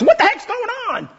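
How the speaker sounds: mock-annoyed customer complaint; mock exasperation. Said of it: "easy for me to get all angry".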